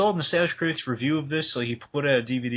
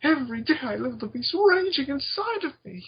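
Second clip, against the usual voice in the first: high-pitched manic mad scientist; Doctor Insano; 13 semitones higher than usual. Acted. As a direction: shriek it like a madman. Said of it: "the Doctor Insano".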